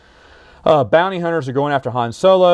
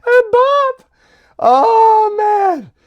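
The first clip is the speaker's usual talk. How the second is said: much higher, a gleeful cry on bop; delighted cry; much slower than usual. Real, not acted. Relishing the stormtrooper bop joke, gleeful high cry. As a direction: squeal with delight at the gag.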